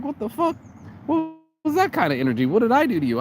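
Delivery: high-pitched, incredulous protest; indignant disbelief. Retold or imitated. Retelling his reaction to being blocked, indignant.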